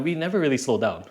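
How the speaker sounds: ordinary talk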